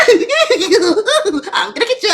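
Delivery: high, laughing, slow; cracking up mid-quote. Retold or imitated. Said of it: "Let me say that one more time".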